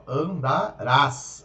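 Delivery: "andarás" slow, stress on the final rás; "andarás" with the final syllable stressed; stressed ending. Shown as a demonstration. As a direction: say "andarás" slowly, leaning on rás.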